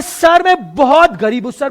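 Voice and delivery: mock whiny sob story; mock crying plea. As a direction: fake a tearful beg for mercy. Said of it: "रोना शुरू करता है".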